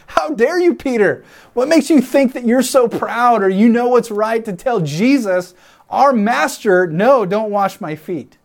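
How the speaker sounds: high-pitched, affronted scolding; acted outrage, pitch jumping up; indignant rebuke. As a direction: scold a friend, aghast at his nerve.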